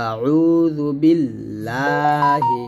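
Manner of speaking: slow recited আউযু বিল্লাহি with a thin লা; Quran recitation. Shown as a demonstration. Teacher recites to show the light lam, slow recitation. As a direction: chant it slowly, লা kept light. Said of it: "এখন লা বলবেন".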